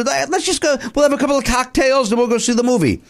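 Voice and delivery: put-on high, giddy girls'-night voice; ladies on a night out. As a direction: pitch it up, breezy and eager for cocktails. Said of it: "they had that energy".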